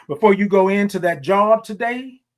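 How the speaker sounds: ordinary talk, nothing marked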